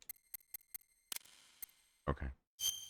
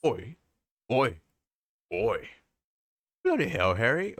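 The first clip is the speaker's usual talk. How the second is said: hammed-up British accent; slower than usual. Streamer goofing around mid-game in a mock British accent. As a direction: play a cartoon Londoner in a mock British accent. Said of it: "I'm gonna speak in a British accent now".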